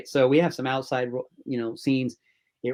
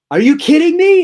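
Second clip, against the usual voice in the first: high, loud disbelieving shout; shouting with joyful shock; excited yell; 12 dB louder than usual. Retold or imitated. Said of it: "He's yelling".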